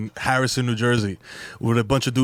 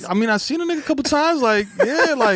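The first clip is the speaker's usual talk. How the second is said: awkward reply; 10 semitones higher than usual. Retold or imitated. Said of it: "I'm like".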